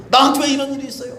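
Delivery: high, fast plea; retold pleading to God; pleading complaint. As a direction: cry out why me.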